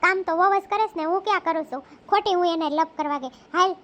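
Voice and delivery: put-on low scolding old lady voice; grumbling mother-in-law. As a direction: mutter like a cranky old mother-in-law about her daughter-in-law. Old mother-in-law muttering to herself.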